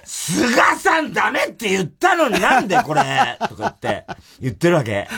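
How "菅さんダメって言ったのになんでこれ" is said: high, slow, tearful mock shout; mock angry rant. The boss furious at Suga's gift, a high slow mock rant. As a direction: rant it like a wounded lady. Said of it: "すげえ怒ってんの".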